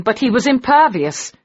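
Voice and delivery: ordinary delivery, nothing marked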